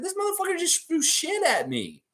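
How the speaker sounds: high, seething mascot voice; angry raptor. Retold or imitated. Raptor losing the tug of war, high seething voice. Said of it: "He's like".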